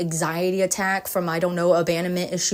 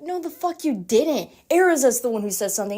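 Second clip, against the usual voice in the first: ordinary delivery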